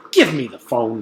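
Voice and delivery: loud, demanding shout. A narrator's reading. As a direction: bark the order. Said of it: "shouted Doctor Payne".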